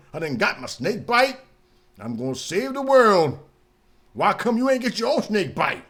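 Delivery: loud, proud hammed-up boast; mock preachy pitch. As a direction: sell it like a savior.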